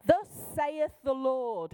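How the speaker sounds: slow, authoritative put-on prophecy voice; King James prophet. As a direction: proclaim it like an old-fashioned prophet, drawn out. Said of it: "this is the King James style person".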